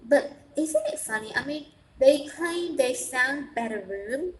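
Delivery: ordinary talk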